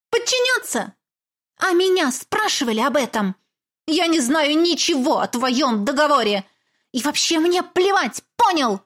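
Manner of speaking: high, loud indignant protest; indignant outrage. Acted. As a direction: shout back in disbelief. Heroine indignantly refuses.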